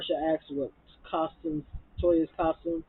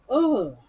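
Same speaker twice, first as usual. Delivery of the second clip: disgusted groan; 8 semitones higher, 8 dB louder than usual. Real, not acted. Disgusted groan after retold rant.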